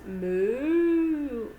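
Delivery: loud, long moo; playful drawn-out cow imitation.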